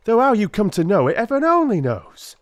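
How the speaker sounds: a hint of a chatty constable recounting his rounds; constable Rance's voice. A narrator's reading. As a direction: tell the night's beat like an eager, talkative policeman. Rance resumes, still amazed.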